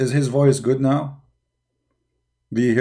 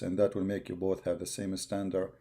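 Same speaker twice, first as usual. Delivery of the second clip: much softer, dropping the volume to match the other mic; turned-down voice for a level check; lowered voice; 3 semitones lower, 12 dB quieter than usual. Real, not acted. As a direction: speak quietly to balance the microphones. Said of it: "I will lower my voice".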